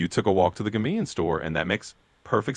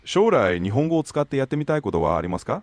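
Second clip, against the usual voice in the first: fast native Japanese question; 5 semitones higher, faster than usual. Acted. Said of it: "Repeat it for you in Japanese only".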